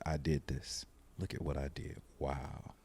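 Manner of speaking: put-on soft, awed murmur over the sleeping baby; hushed awe. Father marvelling at his newborn, hushed and awed. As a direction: breathe it out in quiet wonder.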